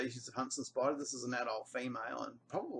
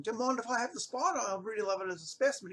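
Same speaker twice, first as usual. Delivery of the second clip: asking politely; 9 semitones higher, faster than usual. Retold or imitated. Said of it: "I better ask him".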